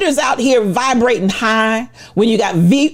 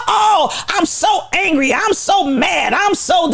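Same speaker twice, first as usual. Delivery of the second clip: high, whiny mock complaint; hammed-up whining about being mad; mocking whiny anger; 7 semitones higher than usual. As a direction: parody the self-pitying angry person. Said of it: "If you're so".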